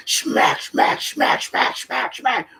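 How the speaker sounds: whispered smack noises; punch sounds. Retold or imitated. Imitating Big Slim's fight with smack noises. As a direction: mimic fists landing.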